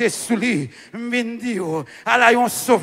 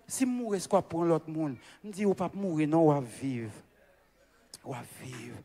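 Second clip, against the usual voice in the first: very soft, low voice; quiet, low retold exchange; hushed retelling; 7 semitones lower, 17 dB quieter than usual. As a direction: drop right down, low and hushed. Retold tender exchange with spouse.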